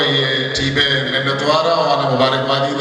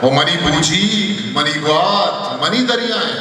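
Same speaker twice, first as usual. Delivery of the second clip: measured, higher-pitched poetry recital with sustained lines; ghazal recitation; 6 semitones higher than usual. Acted. Poet presenting a ghazal to a gathering, measured higher recitation. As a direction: recite verse in a lilting mushaira cadence, falling on the refrain.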